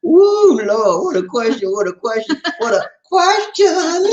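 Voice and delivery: high, hammed-up astonishment, stretching the last repeat; mock amazement. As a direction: play up being floored by it. Mock amazement at a big question.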